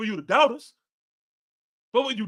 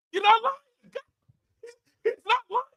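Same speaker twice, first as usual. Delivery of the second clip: higher, talking through real laughter; laughing; 9 semitones higher, 3 dB quieter than usual. Real, not acted. Laughing as he admits the Cowboys fan is right. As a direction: concede the point while cracking up. Laughing while conceding to Danny.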